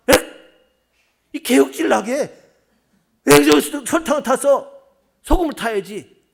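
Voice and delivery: loud, high indignant protest from the husband; angry husband. Retold or imitated. Gyeongsang husband scolding his bride over sugar, angry and loud. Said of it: "그렇게 얘기했어요".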